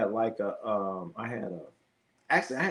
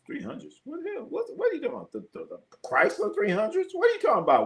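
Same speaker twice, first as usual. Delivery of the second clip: high disbelieving outburst; can't believe what chat just said; incredulous; 14 semitones higher, faster than usual. Real, not acted. Reacting to chat, high incredulous what-the-hell.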